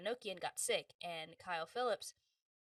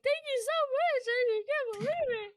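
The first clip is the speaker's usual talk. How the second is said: much higher and louder, voice near tears; overwhelmed thanks, almost crying; tearful excitement; 8 dB louder than usual. Retold or imitated. She thanks the director for the role, high tearful joy.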